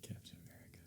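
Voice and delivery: very soft, voiceless whisper. Real, not acted. Guest picks a favourite hero, whispering. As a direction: whisper the answer almost inaudibly. Hushed answer to quiz question.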